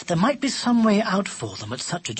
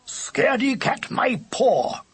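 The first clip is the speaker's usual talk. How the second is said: higher, indignant character outburst; indignant shout; 9 semitones higher, slower than usual. A narrator's reading. Lubber answers being called a scaredy cat, indignant shout. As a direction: throw the insult back, pitched high. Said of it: "shouted Lubber".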